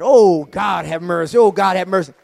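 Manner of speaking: a loud cry of awe; loud fervent exclamation. Real, not acted. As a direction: call it out across the church. Preacher repeats his exclamation.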